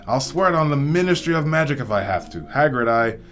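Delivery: high, loud, agitated protest; Hagrid, ranting and raving. A narrator's reading. Hagrid swears to Dumbledore it wasn't Harry, ranting. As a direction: rant without letting anyone cut in. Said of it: "Hagrid went ranting and raving".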